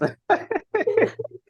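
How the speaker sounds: high, short amused laugh; light laugh. Real, not acted. Laugh after Thailand rice.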